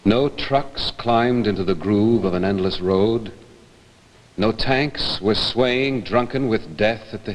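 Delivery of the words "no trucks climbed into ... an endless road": ordinary talk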